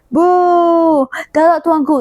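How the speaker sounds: teacher acting out the crowd's protest cry; crowd shout. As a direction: ham up the crowd booing, then hail the king. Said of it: "the thing they were shouting".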